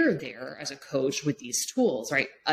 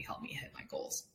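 whispered; 17 dB quieter than usual. Real, not acted. Key point said softly.

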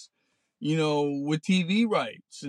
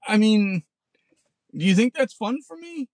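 ordinary talk, nothing marked

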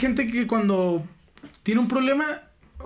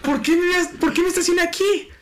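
exasperated whine; 9 semitones higher than usual. Retold or imitated. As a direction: wail at your own bad choice. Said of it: "Parece experiencia real".

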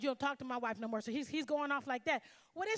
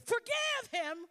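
slow, higher, louder plea; firm command; 9 semitones higher, 4 dB louder, much slower than usual. Retold or imitated. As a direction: press it home slowly.

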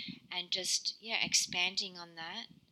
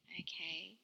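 whisper; 9 dB quieter than usual. Real, not acted. Whispered aside to listeners.

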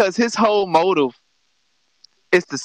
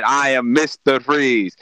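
villain intro; 6 semitones lower, slower than usual. Retold or imitated. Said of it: "the man came on the scene and said".

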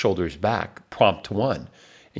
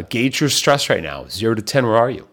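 ordinary talk

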